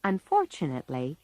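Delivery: ordinary delivery, nothing marked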